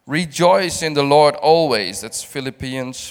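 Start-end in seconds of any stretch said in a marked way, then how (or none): 0.1-2.0 loud, ringing declaration of the verse; preached verse; proclaimed scripture. Acted. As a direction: call it out to the congregation.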